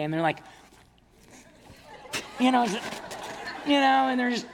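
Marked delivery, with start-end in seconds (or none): 2.4-4.5 high, loud talk through laughter; laughing at his daughters' weak squeeze. Real, not acted. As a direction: laugh while straining to tell it.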